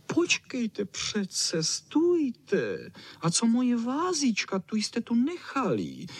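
high, whiny dog voice; Čau Čau whining. Acted. Čau Čau calls the others back for the vase, whining. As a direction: whine it up high, drawing out the plea. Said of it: "kňučel Čau Čau".

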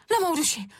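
put-on high child cry, near tears; tearful exclamation. A narrator's reading. Šárka crushed the glasses, tearful exclamation. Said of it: "zvolala Šárka se slzami na krajíčku".

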